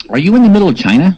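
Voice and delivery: high-pitched attempt at imitating a co-host; Lee impersonation. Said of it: "That's my Lee impersonation I'm doing".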